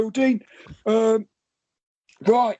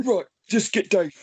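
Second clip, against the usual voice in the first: normal talking voice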